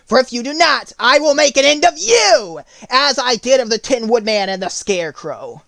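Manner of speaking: high, loud and threatening witch; harsh, severe witch. A narrator's reading. Witch threatening Dorothy, harsh and severe. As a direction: bark it down at her like a cruel witch. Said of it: "harshly and severely".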